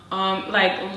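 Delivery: ordinary talk, nothing marked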